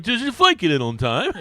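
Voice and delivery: laughing mock English lilt; a giggly imitation of a borrowed English accent. Telling the podcast host about Harkes's new accent, laughing mock English. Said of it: "When did you start speaking like that?".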